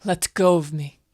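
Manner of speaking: loud, insistent protest; forceful demand. Acted. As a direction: order your captor to release you. Captive demands release from attacker.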